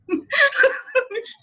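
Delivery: real high-pitched laughing fit; breaking up into high giggles; hard laughing. Real, not acted. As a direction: laugh helplessly at your own joke. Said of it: "So I'm laughing so hard".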